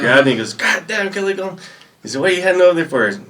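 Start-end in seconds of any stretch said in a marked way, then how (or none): 0.5-1.6 high, gruff drill sergeant voice; drill sergeant bark. Retold or imitated. Drill sergeant calling out a recruit, high gruff bark. 2.2-3.1 higher, louder drill sergeant demand. Retold or imitated. Drill sergeant demanding why recruit walks over, high and loud.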